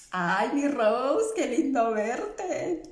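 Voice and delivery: slow, drawn-out happy hello; delighted greeting. Real, not acted. Greeting viewer Rose live, delighted and laughing.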